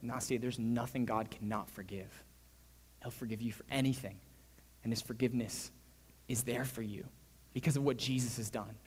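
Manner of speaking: low, soft, steady assurance; hushed, tender promise; gentle reassurance. Retold or imitated. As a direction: comfort her quietly, pausing between phrases. Reassures doubting girl of God's forgiveness.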